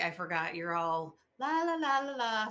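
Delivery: sing-song mock la la la, rising high; high airy la-la-la mockery. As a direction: tease her as a floaty hippie. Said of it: "I had someone say to me".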